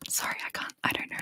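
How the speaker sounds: usual speaking voice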